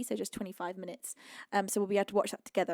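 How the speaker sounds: ordinary delivery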